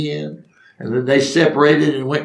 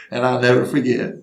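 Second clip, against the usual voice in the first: ordinary delivery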